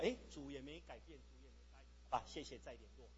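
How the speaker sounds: low, very quiet resigned mumble; acted deflated shrug; giving up. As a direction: drop the voice and quit half-heartedly. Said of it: "我们的祷告往往是这样子".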